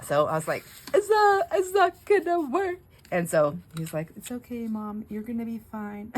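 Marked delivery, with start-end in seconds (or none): 0.9-2.8 much higher, timid squeak of a question; small doubtful voice. Retold or imitated. Doubtful mum asks son. 4.1-6.1 softer, gently soothing tone; calm mum down like a patient son; reassuring son. Retold or imitated. Son reassuring his anxious mum.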